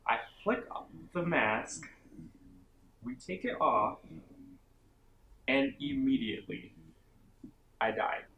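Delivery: milk each phrase like a tragic ending; mock dramatic. Vlogger hams up taking off the mask, mock dramatic. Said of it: "I'm being a little dramatic".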